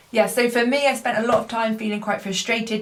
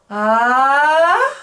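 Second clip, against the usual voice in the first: sung "ah" sliding up until it cracks, louder; voice break; 5 semitones higher, 7 dB louder than usual. Shown as a demonstration. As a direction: let the voice flip at the break. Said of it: "so for example".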